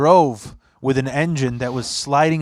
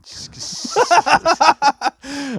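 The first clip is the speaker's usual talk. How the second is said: high burst of laughter; 13 semitones higher than usual. Real, not acted. Laughing at crude banter.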